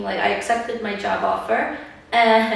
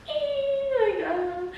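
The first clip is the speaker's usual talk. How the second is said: coy dodge; 12 semitones higher, 5 dB quieter, slower than usual. Retold or imitated. Dodging friends' questions, imitating herself.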